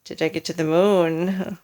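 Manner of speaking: mock-shocked husband's exclaim. Husband fakes alarm to his wife, mock exclaim. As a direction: feign horror. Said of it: "exclaimed Mister Twit".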